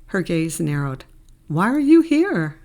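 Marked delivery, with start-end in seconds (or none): 1.5-2.6 high, loud, wary demand; sharply questioning, voice jumping up; suspicious challenge. Acted. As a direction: challenge an unexpected visitor, pitched up and louder. Her gaze narrowed, challenging him.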